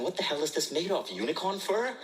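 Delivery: high-pitched comic outrage; indignant inner rant. Retold or imitated. Inner rant about an overpriced sweater.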